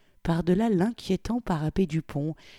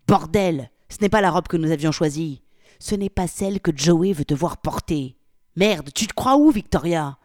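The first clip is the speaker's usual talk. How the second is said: angry rant; 7 dB louder than usual. Acted. Polly berating Victoria.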